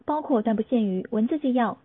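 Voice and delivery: ordinary talk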